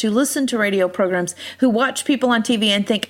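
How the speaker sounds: ordinary delivery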